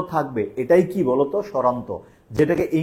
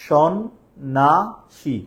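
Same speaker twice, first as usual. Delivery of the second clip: সন্ন্যাসী drawn out slowly in three separate syllables; much slower than usual. Shown as a demonstration. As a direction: count the beats of সন্ন্যাসী aloud. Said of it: "কয়টা অক্ষর বলো তো?".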